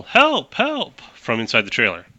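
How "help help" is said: high-pitched cry for help; acted yell for help. Game master voices a captive yelling from inside the trailer, high cry for help. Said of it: "you hear a yell".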